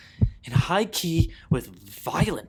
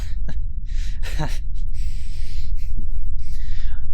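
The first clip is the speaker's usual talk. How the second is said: a long breathy mad cackle; gleeful laugh; 3 semitones higher, 7 dB louder than usual. Acted. Killer laughing over his hidden crime, breathy cackle.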